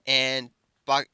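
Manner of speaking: usual speaking voice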